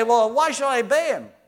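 loud, high mocking challenge; scoffing worldly voice. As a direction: toss the question back with disdain. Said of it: "They say".

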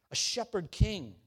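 ordinary delivery

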